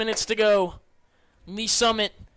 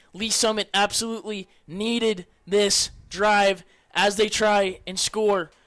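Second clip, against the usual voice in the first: usual speaking voice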